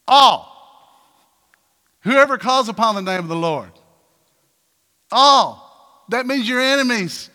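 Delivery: loud, high-pitched pulpit preaching; full-force preaching. Acted. Preacher calling the congregation to love enemies, full force. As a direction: lift the room with every all.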